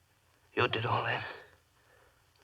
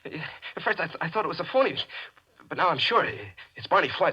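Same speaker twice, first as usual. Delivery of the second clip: panicky pleading; 8 semitones higher than usual. Acted.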